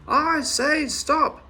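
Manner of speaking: high, slow, loud command; yelling. A narrator's reading. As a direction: bellow the order. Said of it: "yelled the big man".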